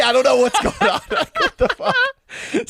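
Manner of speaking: high-pitched, cracking up in disbelief; laughing bafflement. Real, not acted. Storyteller baffled by rumours about himself, laughing. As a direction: squeal it out into a laugh.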